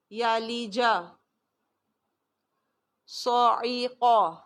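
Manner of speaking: each word recited and repeated; slow Quranic recitation drill. Shown as a demonstration. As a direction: chant the words as a reading drill. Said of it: "دوبارہ سے پڑھوں گی".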